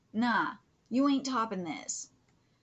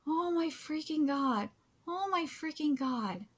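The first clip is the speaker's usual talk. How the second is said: ordinary talk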